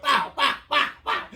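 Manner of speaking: loud high pow noises; belt smack sounds. Retold or imitated. Imitating his mom's belt hitting him, loud.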